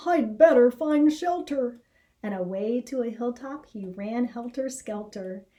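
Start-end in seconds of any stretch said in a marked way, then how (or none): 0.1-1.8 louder, urgent rabbit voice; worried rabbit. A narrator's reading. Harold deciding to run for shelter, urgent and loud. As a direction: hurry to get out of the rain. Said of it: "said poor Harold".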